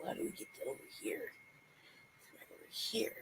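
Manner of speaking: fast unvoiced whisper. Real, not acted. Speaker looking for the way while filming, whispered. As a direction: whisper so nobody overhears.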